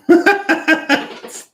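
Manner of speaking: loud high giggle; high burst of laughter. Real, not acted. Laughs after the tarot reading.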